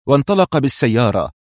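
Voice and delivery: plain reading voice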